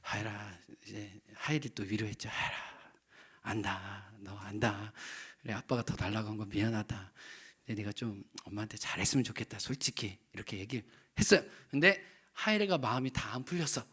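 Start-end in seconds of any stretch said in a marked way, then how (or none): 0.0-10.2 softer, lower tender consoling; quiet fatherly reassurance; consoling son. Retold or imitated. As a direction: gently comfort the upset son. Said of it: "뒤차로 가가지고".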